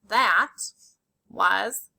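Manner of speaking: very slow, word by word; slow dictation. Shown as a demonstration. Teacher dictating to students, very slow. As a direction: stretch out That was his. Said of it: "Say it with me".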